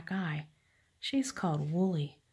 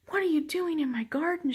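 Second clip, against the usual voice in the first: much higher, mildly raised shout; story-book shout; 10 semitones higher, 4 dB louder than usual. A narrator's reading. Farmer finding the sheep in her garden, shouting. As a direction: shout it in a mild storybook scold. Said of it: "she shouts".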